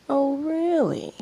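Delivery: breathy, slow, knowing tease; smirking. A narrator's reading. Ino taunting the manager, smirking. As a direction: purr it like an evil smirk. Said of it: "Ino smirked evilly".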